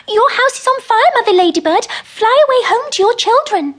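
put-on high, loud, urgent old woman's call; urgent little old woman. Little old woman warns Mother Ladybird of fire, high urgent call.

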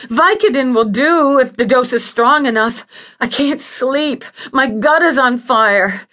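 loud, high-pitched desperate pleading; patient wailing in pain for relief; pained, demanding cry. Acted. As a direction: beg loudly and high, urgent with pain.

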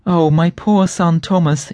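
anguished exclaim. A narrator's reading. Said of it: "exclaimed Tabitha".

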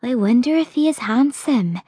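a touch of daydreaming; dreamy. A narrator's reading. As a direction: wonder aloud, lightly dreamy. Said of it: "said Alice dreamily".

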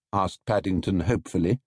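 ordinary delivery

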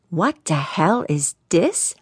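slow, measured exasperation; slow fuming. A narrator's reading. Valentino repeats his demand to the young hotshot, slowly fuming. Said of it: "Smoke was starting to come out".

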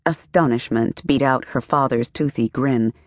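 plain reading voice

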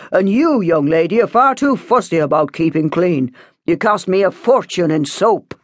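stern father laying down the law; father's firm telling-off; scolding father. A narrator's reading. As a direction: overrule the daughter irritably.

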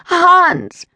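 high, loud panicked cry for help; shrill scream of a name; frightened cry. Acted. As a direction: call out to him in terror. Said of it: "she cried".

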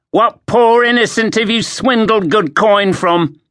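put-on high, jeering young soldier; mocking guard. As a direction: jeer at him. Cresset guard accuses.

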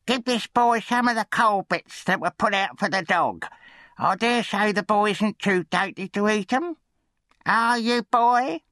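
very high-pitched, grudging scold; scolding undertaker's wife. A narrator's reading. Undertaker's wife sends the new boy downstairs, high scolding. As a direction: order the boy about in a high, sour voice.

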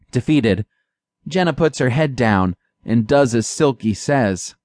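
ordinary delivery, nothing marked

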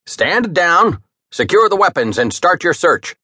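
high, firm orders; commanding captain. A narrator's reading. Captain ordering his boatswain to stand down, firm. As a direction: issue the orders crisply.

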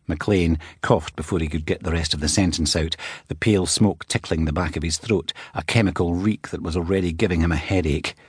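ordinary delivery, nothing marked

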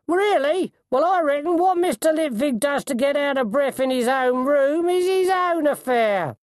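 very high, loud, knowing coachman; high-pitched, insinuating character voice; coachman defending the postmaster. A narrator's reading. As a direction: rebuke a nosy colleague on a gentleman's behalf.

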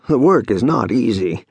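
ordinary delivery

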